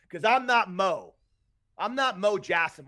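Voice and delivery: bang out each word of 'Cause I'm not Mo; a real, heated shout of frustration; heated outburst. Real, not acted. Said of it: "I got a little heated up".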